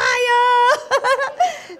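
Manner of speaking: loud, high burst of laughter; big delighted cackle; whooping laugh. Real, not acted. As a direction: shriek with laughter at your own joke. Bursts out at own joke.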